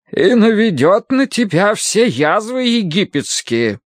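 high, slow scripture declamation; stern Bible-reading woman. Acted. Woman with a Bible over a patient, menacing high intoning. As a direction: intone the curse in a high, drawn-out voice. Mrs. Gadson reads scripture.

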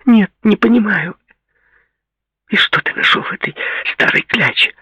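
put-on high-pitched, indignant girl's voice; hurt and angry. A narrator's reading. Jilted girl attacks Paul over his new woman, hurt and angry.

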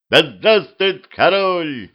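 high, long-drawn cheer; cheerful shout. A narrator's reading. Guards cheer the king for beer, merry shout. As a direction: cheer slowly and merrily for the king. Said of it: "весело крикнула стража".

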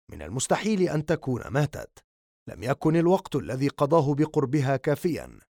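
ordinary delivery

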